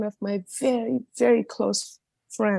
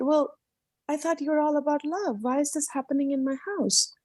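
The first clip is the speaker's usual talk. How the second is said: retold child's higher-pitched challenge to God; higher, mildly pleading reproach; hurt protest; 6 semitones higher than usual. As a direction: lightly voice a wounded child questioning God. Child challenging God after family fight.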